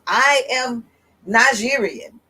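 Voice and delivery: ordinary delivery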